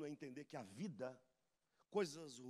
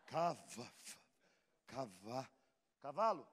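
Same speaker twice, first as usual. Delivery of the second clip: mock stammer; 4 semitones lower, slower than usual. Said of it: "retold stutter exchange".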